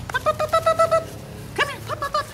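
calling a puppy. Real, not acted.

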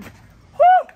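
very high, loud woo; excited whoop. Real, not acted. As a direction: yell out a woo. Cold hands on bar.

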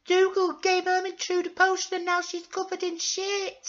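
loud, very high character voice; frantic high-pitched report; tattling falsetto. Acted. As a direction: tell tales in a shrill squeal.

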